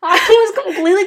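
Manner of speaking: high, laughing through the words; can barely speak for laughing; laughing hard. Real, not acted. Retelling choking, laughing hard.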